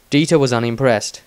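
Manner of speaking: plain reading voice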